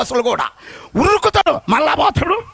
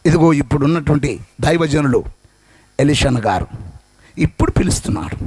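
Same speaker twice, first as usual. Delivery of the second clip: fervent sermon delivery; preaching; 4 semitones lower than usual. Acted. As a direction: preach to the congregation. Preaching to congregation.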